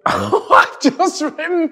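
high, loud burst of laughter; laughing. Real, not acted. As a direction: crack up as the memory hits, drawn out. Laughing at a sudden memory.

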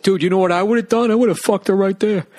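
high, loud mock-bro voice; hammed-up squeaky boast to a buddy; put-on bragging dude.

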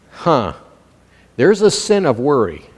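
normal talking voice

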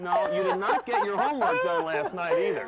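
breaking up with laughter mid-line; can't keep a straight face; laughing through lines. Acted. Father laughing through the skit.

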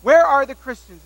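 loud booming yell of "Where are the Christians?"; professor yelling. Retold or imitated. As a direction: shout it out to the whole class. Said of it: "yells out".

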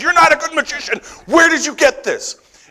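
high, laughing, exasperated demand; exasperated boss. Retold or imitated. Boss demanding where the reports came from, high and exasperated. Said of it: "She says".

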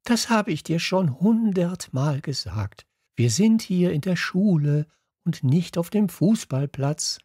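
high-pitched scolding teacher voice; indignant class teacher. A narrator's reading. (empörter Lehrer, mit hoher Stimme)

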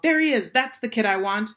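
loud, high excited call. A narrator's reading. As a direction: shout out a sudden find. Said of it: "he pointed and called".

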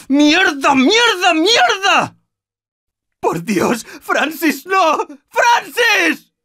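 high frantic cries; anguished shouting. Acted. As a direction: cry out his name in despair. Francis down.

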